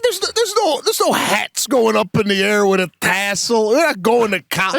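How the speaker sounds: high, shouted mock indignation; mock outrage. Real, not acted. Mocking the fuss over his son's last school day, high mock rant. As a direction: rant it like it's absurd.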